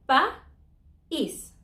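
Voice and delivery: pa-is split slowly into two syllables; slow pa, then is, stress on the i; careful split pa-is. Shown as a demonstration. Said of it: "each letter in a different syllable".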